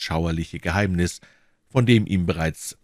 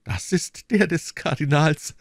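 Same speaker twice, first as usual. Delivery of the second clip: higher, slow female character voice; measured, higher-pitched reply from the young woman; woman's confirming reply; 11 semitones higher than usual. Acted. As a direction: confirm it slowly in a lifted voice. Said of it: "entgegnete Madame Bonacieux".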